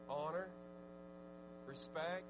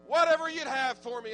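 1 fervent surrender; 7 semitones higher, 11 dB louder than usual. Acted. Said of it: "humble yourself and say".

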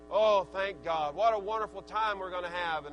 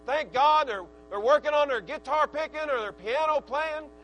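loud, raised, driving sermon voice; full-force preaching; 4 semitones higher, 7 dB louder than usual. Real, not acted. Preacher rousing the church about young people, full-force preaching. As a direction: preach it hard, climbing to a high, loud peak.

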